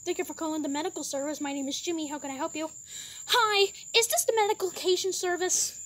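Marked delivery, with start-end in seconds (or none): 0.0-2.7 lower, quicker call-centre operator voice; operator Jimmy. Pretend. Medic service operator Jimmy answers the driver, low and brisk. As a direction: answer the phone like a calm service clerk.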